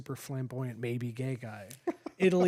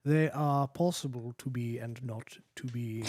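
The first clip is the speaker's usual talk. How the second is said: British accent. Shown as a demonstration. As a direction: hold the British accent through it. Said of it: "with a British accent".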